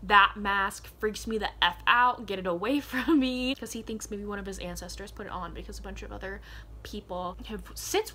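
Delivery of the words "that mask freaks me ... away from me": loud, alarmed quote; louder retold recoil, laughing at the end; mock freaked-out outburst. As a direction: push the mask away in mock panic. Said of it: "Helion was like".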